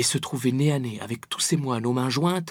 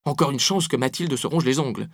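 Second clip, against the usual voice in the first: plain reading voice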